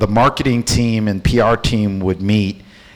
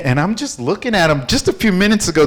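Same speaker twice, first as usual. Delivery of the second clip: usual speaking voice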